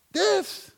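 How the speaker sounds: This said high and pointed, one word; emphatic answer. Real, not acted. Pastor answers how to grow the church, pointed emphasis. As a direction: land the word This like the whole answer.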